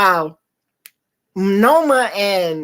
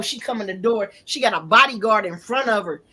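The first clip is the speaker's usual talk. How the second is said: normal talking voice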